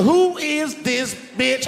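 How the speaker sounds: high, loud, slow accusing question; angry demand. Retold or imitated. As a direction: drag it out furiously. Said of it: "She said".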